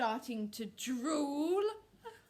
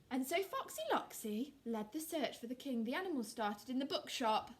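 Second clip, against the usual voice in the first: plain reading voice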